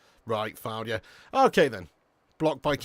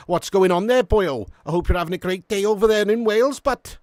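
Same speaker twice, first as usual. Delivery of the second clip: loud, raised mock-Welsh greeting; playful Welsh accent; 6 semitones higher, 9 dB louder than usual. Streamer greets a viewer in Wales, playful Welsh accent. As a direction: call out a hearty mock-Welsh hello.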